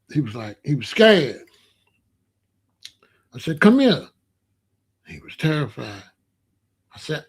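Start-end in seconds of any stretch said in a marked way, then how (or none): 3.6-4.1 firm, higher, louder call across the playground; raised summons. Retold or imitated. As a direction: call the kid over sharply. Calling a scared boy over.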